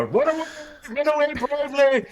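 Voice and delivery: high, loud mock cry of retreat; hammed-up comic coward; Monty Python knight. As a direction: shriek it like a fleeing knight. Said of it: "scene from Monty Python and the Holy Grail".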